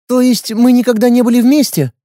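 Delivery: put-on high, hopeful young woman; girl voice. Girl voice, far timbre.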